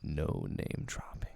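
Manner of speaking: low whispered quote, slow. Retold or imitated. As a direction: hush the quote right into the mic. Quoting Trey in a hush.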